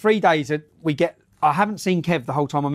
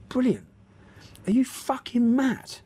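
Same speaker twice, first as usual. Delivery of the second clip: slow, disbelieving, softer; mock Kev's outraged disbelief; imitating his mate, slow and incredulous; incredulous retort; 6 semitones higher, 4 dB quieter, slower than usual.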